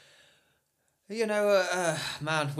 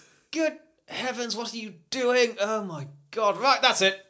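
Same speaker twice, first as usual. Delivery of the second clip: posh outraged Brit; 7 semitones higher than usual. Pretend. Playing a colonial Brit, outraged.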